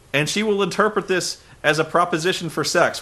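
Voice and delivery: ordinary talk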